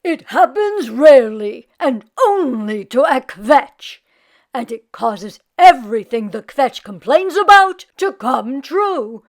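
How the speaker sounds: solemn rabbi voice. Acted. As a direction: lecture like a wise rabbi. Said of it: "the rabbi".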